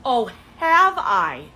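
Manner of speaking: loud sarcastic "Oh, have I?" with slow, drawn vowels. Real, not acted. Mocking the date's compliment with loud sarcasm.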